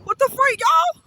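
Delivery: high, startled cry to viewers; shocked outburst. Real, not acted. Caught in a gust, startled high cry to viewers. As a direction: blurt it out in disbelief.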